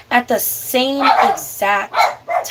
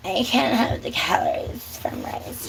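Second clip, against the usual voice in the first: softer, mocking imitation; put-on complaint, half-voiced and mocking; mocking quote; 6 dB quieter than usual. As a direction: mimic a fussy dieter under your voice. Mocks a rice avoider's complaint.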